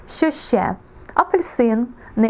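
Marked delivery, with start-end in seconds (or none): none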